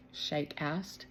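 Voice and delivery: ordinary delivery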